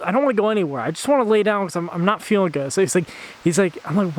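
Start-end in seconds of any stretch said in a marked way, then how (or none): none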